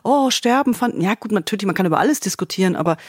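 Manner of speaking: ordinary talk, nothing marked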